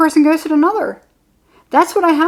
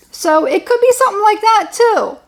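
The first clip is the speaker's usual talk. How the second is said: ordinary delivery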